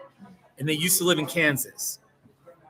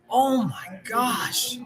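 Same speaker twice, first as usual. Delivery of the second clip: very slow, higher, stretched-out oh my gosh; drawn-out exasperation; 8 semitones higher, much slower than usual. Real, not acted. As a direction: drag every syllable out in disbelief. Dismay about northern Texas towns.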